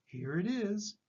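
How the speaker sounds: plain reading voice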